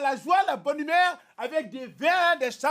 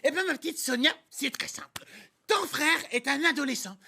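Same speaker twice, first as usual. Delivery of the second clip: silly clown; 2 semitones lower than usual. Acted. Kids' TV host Patafouin.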